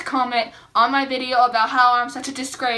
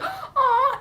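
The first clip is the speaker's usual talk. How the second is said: much higher, laughing coo; cooing aww; 14 semitones higher than usual. Retold or imitated. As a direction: coo at the cute baby elk. Baby calf looked so cute.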